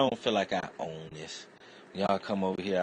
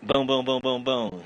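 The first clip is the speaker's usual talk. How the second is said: loud high boom boom sounds; loud imitated gunfire; gunshot noises; 7 semitones higher, 8 dB louder than usual. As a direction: mimic rapid gunfire.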